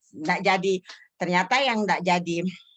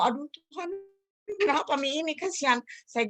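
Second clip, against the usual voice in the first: pleading lament; 7 semitones higher than usual. Retold or imitated. Lamenting to God over the sick one, high plaintive plea. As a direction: lament in a raised, softened voice. Retells her anguished cry to God.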